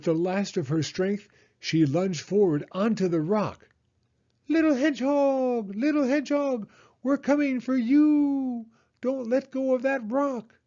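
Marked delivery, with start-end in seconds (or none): 4.5-10.6 an owl calling reassurance from above; Owl's call. A narrator's reading.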